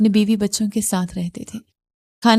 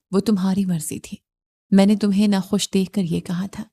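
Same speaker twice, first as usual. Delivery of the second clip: ordinary delivery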